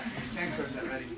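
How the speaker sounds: ordinary talk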